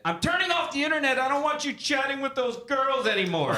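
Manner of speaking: high, loud mock shriek; shrill scream. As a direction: screech it like an angry wife. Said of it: "In a more shrill scream".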